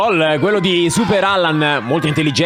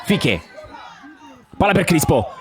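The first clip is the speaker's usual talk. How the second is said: ordinary talk, nothing marked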